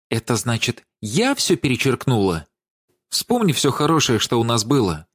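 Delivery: mildly whining, imploring appeal; pitiful pleading. A narrator's reading. Владик begs Даша for a second chance, pitiful pleading. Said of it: "тянул Владик жалким, умоляющим тоном".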